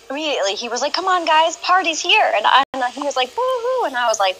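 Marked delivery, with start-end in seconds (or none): 0.9-2.3 high, eager party call; rally the crowd into the fun; excited invitation. Retold or imitated. Tox joining the party. 3.4-3.9 high whooping cheer. Retold or imitated. Cheering in chat.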